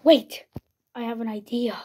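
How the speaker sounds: ordinary talk, nothing marked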